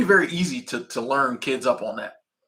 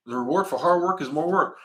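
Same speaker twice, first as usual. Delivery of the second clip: mock boss; 4 semitones higher than usual.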